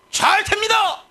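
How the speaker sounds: high, loud declaration of faith; pastor's confident cry. Acted. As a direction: proclaim it loudly to the downhearted. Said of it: "이래야지 목사가".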